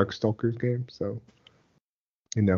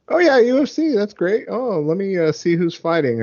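fake enthusiasm; 10 semitones higher, 5 dB louder than usual. Feigning interest before sneaking off.

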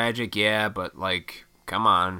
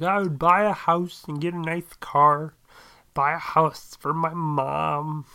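high, louder, naive lotto-dreamer voice; mocking everyman; 9 semitones higher, 4 dB louder, slower than usual. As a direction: play the wide-eyed winner, slowing at the end. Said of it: "Everybody's like".

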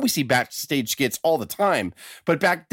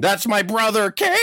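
hammed-up wrestling drama; 7 semitones higher than usual. Host mocking soap-opera wrestling, hammed-up dramatic reveal. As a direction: proclaim it like an over-the-top promo.

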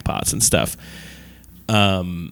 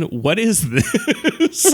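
mock Clooney incredulous; 13 semitones higher, much slower than usual. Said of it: "he's like".

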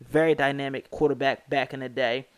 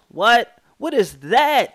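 incredulous; 7 semitones higher, 7 dB louder, slower than usual. Real, not acted. Shocked by interception stats.

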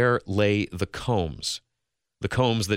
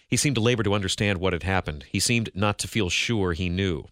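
usual speaking voice

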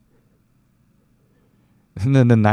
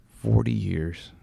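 "Forty years" repeated softer, almost to himself; soft echo; 6 dB quieter, slower than usual. Real, not acted. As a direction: let "Forty years" fall away quietly in disbelief. Echoing the album's age softly.

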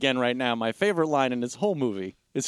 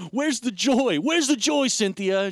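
high-pitched mock exasperation; agitated demand; 6 semitones higher, slower than usual. Imitating a husband hunting for detergent, agitated demand. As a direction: demand it urgently, pitched well up.

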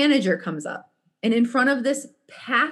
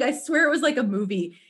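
ordinary talk

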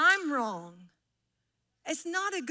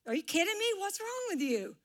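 high, incredulous mock outburst at the child; exasperated parent; 7 semitones higher than usual. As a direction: act out a parent losing patience, pitched up. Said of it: "and you're like".

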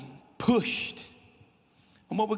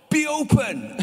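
called out; 9 semitones higher, 5 dB louder than usual. Shown as a demonstration. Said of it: "and just go".

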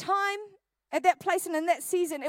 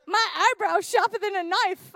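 lightly mocking little brother, slow and raised; boastful little brother; 4 semitones higher, 5 dB louder than usual. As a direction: show off in a slightly higher, louder voice. Acts out brother boasting about eyebrows.

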